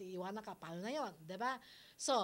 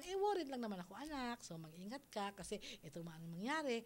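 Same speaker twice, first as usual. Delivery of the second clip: soft, gentle worried mother; calm reassuring parent; 3 semitones lower, 7 dB quieter, faster than usual. Retold or imitated. Host modelling how a mother calmly talks to her child, soft. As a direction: say it softly, like a caring mom. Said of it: "sabihin mo lang".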